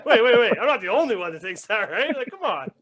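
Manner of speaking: high-pitched pleading imitation; hammed-up wounded innocence; mock disbelief. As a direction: protest as if betrayed by the room. Acts out the shocked man's protest.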